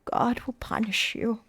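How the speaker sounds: slow, hushed pronouncement; half-whispered, drawn-out warning; whispered condemnation. A narrator's reading. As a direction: whisper it slowly, like a curse. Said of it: "pointed her quivering finger in their direction and whispered".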